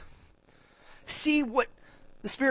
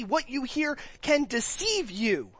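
ordinary delivery

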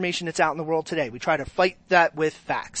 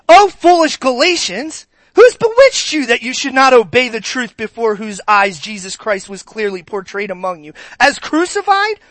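higher, louder, scolding sarcasm; sarcastic rebuke; 5 semitones higher, 6 dB louder than usual. Shown as a demonstration. Pastor reading Paul's rebuke as written, loud sarcastic scolding. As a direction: read it with full snark. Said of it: "I'm gonna read it the way it was written".

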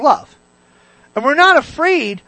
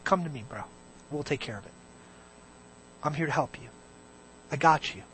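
very soft, low reassurance; reassuring Holy Spirit; 8 semitones lower, 17 dB quieter, faster than usual. Acted. God the Spirit beside someone praying, hushed comforting offer. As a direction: quietly offer help like a trusted buddy. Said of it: "is standing right there going".

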